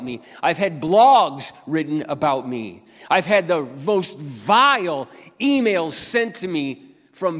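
loud testimony with drawn-out key words; impassioned preaching. Real, not acted. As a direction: preach the grievance with force. Preaches about attacks on himself.

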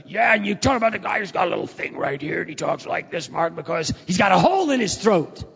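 high, loud, strained father's mimicry; father mimicking a cancer patient, loud and high; dad imitating a throat-hole voice. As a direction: rasp it out like a man with a hole in his throat. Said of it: "My daddy talk about".

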